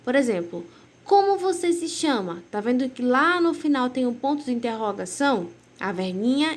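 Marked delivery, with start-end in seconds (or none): 1.0-2.4 asked as a real question, pitched higher. Shown as a demonstration. Teacher modelling a question for pupils, asked with a clear question tone. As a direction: read it like you truly want the answer. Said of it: "ler a frase como um tom de pergunta".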